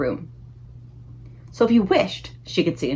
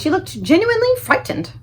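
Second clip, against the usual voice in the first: ordinary delivery, nothing marked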